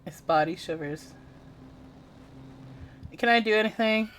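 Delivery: ordinary delivery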